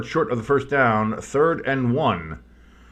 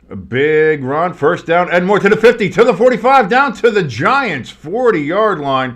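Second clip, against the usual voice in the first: rising, louder excited call; excited play-by-play; 8 semitones higher, 7 dB louder than usual. Acted. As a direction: build the call as the run goes.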